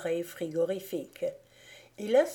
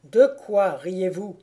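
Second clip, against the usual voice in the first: frightening calm; 9 dB louder than usual. A narrator's reading. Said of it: "reprit avec un calme effrayant".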